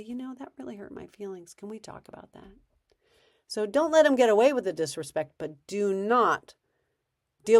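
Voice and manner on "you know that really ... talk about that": much softer, gentle hurt; calm later talk. Shown as a demonstration. Parent calmly revisiting hurt with kid, soft and sincere. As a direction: speak quietly and sincerely. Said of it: "you can say".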